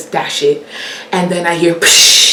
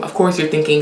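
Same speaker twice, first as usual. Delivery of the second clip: usual speaking voice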